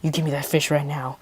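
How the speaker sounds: low-key imitation of a demanding dad; quiet mock menace; softly voiced, lightly stern father. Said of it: "my dad's like".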